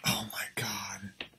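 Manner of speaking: hushed, breathy disbelief; real whispered oh my God. Real, not acted. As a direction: whisper it under your breath. Reacts to blown chance.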